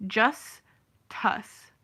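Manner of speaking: "Just Tuss" broken in two, a gap between; spelling pronunciation. Shown as a demonstration. Reading the kid's name as spelt, spelling pronunciation. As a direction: sound out "Just... Tuss", rhyming with fuss. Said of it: "You know how they spelt it?".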